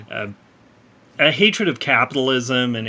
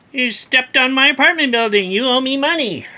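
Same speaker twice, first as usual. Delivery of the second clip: mock indignant woman; 11 semitones higher, 5 dB louder than usual. Woman yelling at the alien that trampled her building, high mock shout. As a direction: ham up an outraged lady yelling at a giant. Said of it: "a woman steps up and says".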